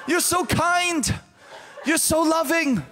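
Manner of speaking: high, slow, love-struck imitation; gushing ticket lady. Ticket agent swooning over a traveler, high gushing mock voice. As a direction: gush like someone overcome with adoration.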